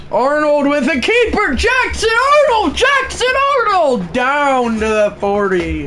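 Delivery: high, loud announcer call; excited play-by-play. Acted. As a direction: shout the runner's name.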